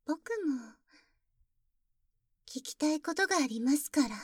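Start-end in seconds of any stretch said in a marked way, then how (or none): none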